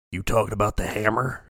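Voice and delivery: low, quiet menacing question; scary gambler. Acted. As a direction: play the heavy, low and soft. Voices big scary gambler.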